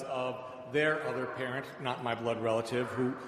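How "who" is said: ordinary delivery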